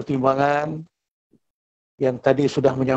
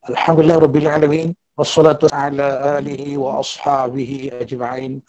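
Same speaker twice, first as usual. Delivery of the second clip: ordinary talk, nothing marked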